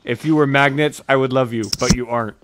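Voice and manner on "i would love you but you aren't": ordinary talk, nothing marked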